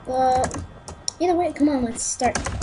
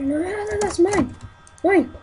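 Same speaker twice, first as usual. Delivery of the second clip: excited protest; 7 semitones higher than usual. Real, not acted. Player protests a stolen kill.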